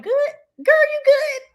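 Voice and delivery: high, loud check-in; imitating concerned cousin. Cousin checking on crying mother.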